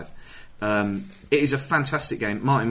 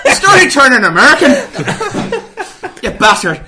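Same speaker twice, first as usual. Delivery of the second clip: spoken high and loud through laughter; laughing; 11 semitones higher, 12 dB louder, slower than usual. Real, not acted. Mocking the accent that turned American, laughing hard. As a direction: squeeze the words out mid-laugh.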